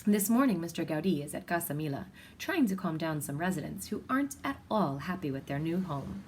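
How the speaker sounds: ordinary delivery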